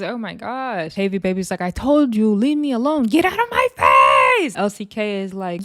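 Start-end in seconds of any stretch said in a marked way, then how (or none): 1.6-4.5 rising to a high, loud screech; mock yelling. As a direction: imitate a furious yell, building to the last words. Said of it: "are just yelling off the top of their lungs".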